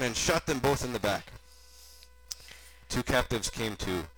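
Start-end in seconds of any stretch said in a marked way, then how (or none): none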